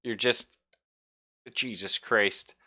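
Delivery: usual speaking voice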